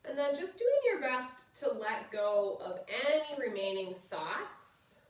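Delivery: soft, low guided relaxation voice. Acted. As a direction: ease them into rest gently. Leads final relaxation.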